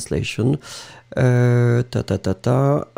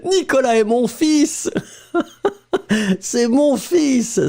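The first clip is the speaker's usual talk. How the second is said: high, indignant c'est mon fils; correcting that Nicolas is her son; mock-indignant correction; 11 semitones higher than usual. Real, not acted. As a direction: insist fils, laughing, much higher. Teacher protests a funny student mistake.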